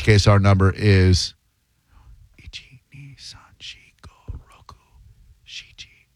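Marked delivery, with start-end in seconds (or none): 2.4-6.1 barely audible whisper; whispered counting. Real, not acted. Host working out the number in Japanese, whispered. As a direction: count under your breath in Japanese, unvoiced whisper.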